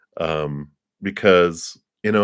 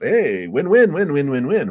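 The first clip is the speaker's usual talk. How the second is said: gleeful, higher and louder chant of win; excited; 8 semitones higher, 5 dB louder than usual. Acted. Hobbyist thrilled by a shop that cleans up, excited. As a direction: cheer each win like you've found the perfect deal. Gushing over the pottery shop.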